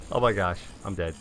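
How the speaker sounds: ordinary talk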